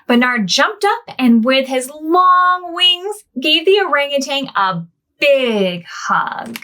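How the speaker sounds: gentle storybook reading to little ones; reading to children. A narrator's reading. Storyteller reading to children, gently. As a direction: read it like a bedtime picture book. Reads picture book aloud.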